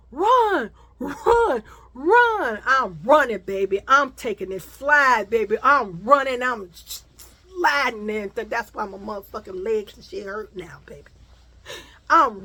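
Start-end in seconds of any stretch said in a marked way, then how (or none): 0.1-2.6 loud high urging shouts; yelled run. Retold or imitated. People yelling at her to run. 8.3-11.1 hushed whisper to the audience; whispering about her aching legs; whispered aside. Real, not acted.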